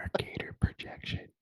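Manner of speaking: breathy seductive whisper; sexy whisper. Pretend. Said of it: "in that sexy voice".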